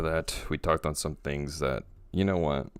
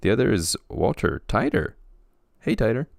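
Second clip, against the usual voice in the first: high, playful introduction and hello; odd announcing voice; 6 semitones higher, 4 dB louder than usual. Real, not acted. Host introducing the next guest, odd high voice. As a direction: introduce and greet in a raised pitch. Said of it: "I don't know why I'm talking like that".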